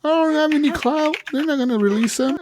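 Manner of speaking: squeaky high panicked voice; timid new guard. Retold or imitated. Guard telling Arthur he has no clout, squeaky and scared. Voicing nervous guard in game story.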